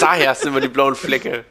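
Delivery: loud, laughing tease; lachend. Real, not acted. Joins the joke, laughing. (laut lachend gesagt)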